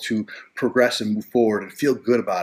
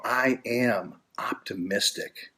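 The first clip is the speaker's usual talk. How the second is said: affirmation voice; 6 dB quieter, much slower than usual. Shown as a demonstration. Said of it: "start to say things like this".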